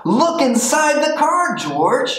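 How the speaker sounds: high, fast animal voices; excited animals. A narrator's reading. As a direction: animals coaxing eagerly.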